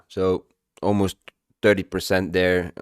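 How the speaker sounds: talking through hiccups; words jolted by hiccups. Real, not acted. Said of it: "Sorry for the hiccups again".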